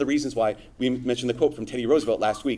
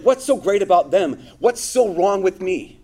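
higher, louder aggrieved question, slowing on the second half; preacher acting out the listener's jealousy, aggrieved; envious whine; 5 semitones higher, 5 dB louder than usual. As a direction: let the hurt build as you drag out what's so wrong with me. Voicing the envious inner question.

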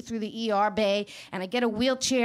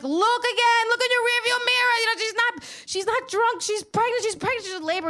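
pleading shout; 11 semitones higher than usual. Retold or imitated. Husband shouting at the cab driver to look. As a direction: yell it at him to look again. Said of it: "I'm like".

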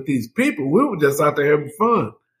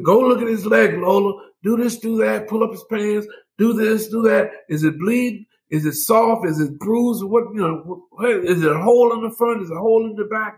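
higher-pitched rapid-fire doctor's instructions; doctor giving orders; 8 semitones higher than usual. Retold or imitated. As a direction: imitate a doctor firing off checks over the phone. Acting doctor's urgent phone instructions.

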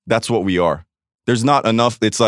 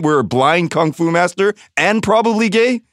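ordinary talk

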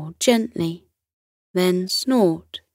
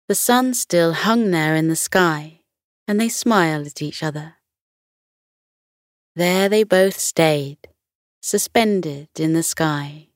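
ordinary delivery, nothing marked

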